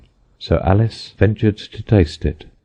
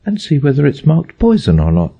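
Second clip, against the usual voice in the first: put-on high, light girlish voice; a young girl checking carefully; Alice voice; 8 semitones higher than usual. A narrator's reading. Alice to herself, high and careful.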